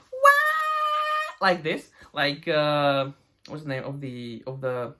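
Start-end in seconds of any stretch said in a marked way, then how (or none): 0.1-1.5 very high falsetto wail; hammed-up high-pitched howl; mock wail. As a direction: wail like a diva.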